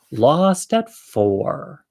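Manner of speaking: very slow, drawn-out words; dramatic title reveal. Real, not acted. Wrapping up the story of getting lost, dramatically slow. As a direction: stretch each word for a grand ending.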